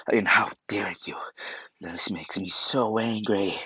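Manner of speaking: whispered fury. Retold or imitated. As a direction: whisper the anger through the teeth. Tanjiro's rage, whispered.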